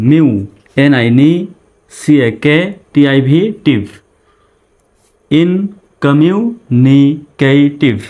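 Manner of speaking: very slow "ইনকমিউনিকেটিভ", broken into separate syllables. Shown as a demonstration. Teacher breaking a big English word down for learners, syllable by syllable. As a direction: break "ইনকমিউনিকেটিভ" apart, a pause after each syllable. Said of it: "ভেঙে ভেঙে উচ্চারণ করার".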